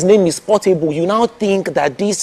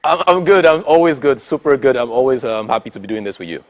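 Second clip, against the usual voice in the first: put-on Edo accent; 8 semitones lower than usual. Host asks [name] for his Edo accent, Edo accent. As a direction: slip into a relaxed Edo accent. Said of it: "Give me your Edo accent".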